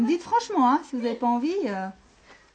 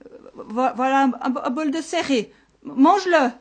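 high stuttering shepherd, growing louder; terrified stammer; 5 semitones higher, 8 dB louder than usual. Acted. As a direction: stammer in fright, then blurt it loudly. Terrified shepherd offers food to monster. (bégaiement apeuré, voix aiguë)

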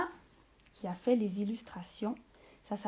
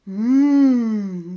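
loud long savouring hum; ogre's hum of delight; 12 dB louder than usual. Acted. Ogre tasting a book, loud savouring hum. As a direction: hum with greedy pleasure. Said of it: "dit l'ogre".

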